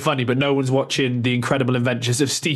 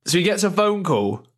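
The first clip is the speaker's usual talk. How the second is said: higher, put-on northern English accent; generic northern accent; 5 semitones higher than usual. Podcaster setting up the manager's phone call, mock northern accent. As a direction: narrate it in a broad mock-northern voice. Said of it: "I'm doing a generic northern accent".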